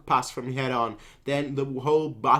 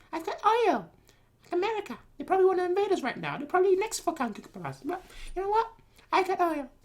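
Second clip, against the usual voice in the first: much higher, singsong taunting; high mocking taunt; 4 dB quieter, faster than usual. Kanki taunting the enemy strategists, high mocking voice. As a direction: tease them like a cocky kid. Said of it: "Kanki's like".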